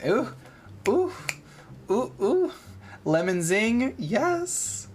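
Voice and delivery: gushing ooh ooh like a fan; high excited squealing. Acted. Neighbor squealing to Damien about lemon cookies, excited.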